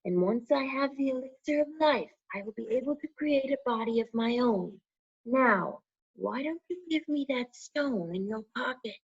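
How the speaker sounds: high, hissing dark lord voice; menacing evil lord, high and cold; Voldemort's voice. A narrator's reading. Voldemort tempting Harry to hand over the stone, high and cold.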